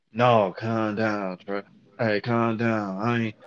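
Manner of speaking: slow, low, soft pleading; a worker begging him to calm down; scared coworker. Retold or imitated. Worker pleading with him to calm down, slow and low.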